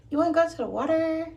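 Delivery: high pet-talk; high dog-talk voice. Real, not acted. Asking the dog.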